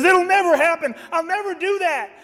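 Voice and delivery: high, insistent vow; boastful Peter. Retold or imitated. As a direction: swear loyalty with eager bravado. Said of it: "And Peter says".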